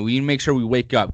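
usual speaking voice

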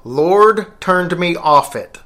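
each word spaced out slowly; slow, deliberate. Shown as a demonstration. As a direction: spell out the hidden message slowly. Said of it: "It's supposed to say".

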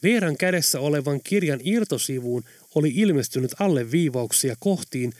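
plain reading voice

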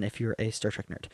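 normal talking voice

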